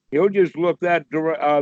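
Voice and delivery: ordinary talk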